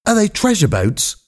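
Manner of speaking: high, eager question; excited. A narrator's reading. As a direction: ask it all excited. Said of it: "Roger asked excitedly".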